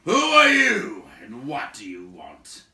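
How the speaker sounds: loud, slow, gruff challenge; gruff giant. A narrator's reading. Woodsman towering over a wizard, gruff demand. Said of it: "he asked gruffly".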